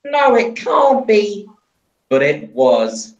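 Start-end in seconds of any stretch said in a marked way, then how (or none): 0.0-1.5 high, loud and slow "Oh no"; dismayed cry. Acted. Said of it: "cried Diesel".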